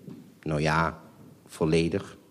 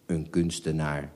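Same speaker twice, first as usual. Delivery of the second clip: somewhat dull; 4 semitones lower, 5 dB quieter than usual. Acted. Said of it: "zei mijn vader enigszins dof".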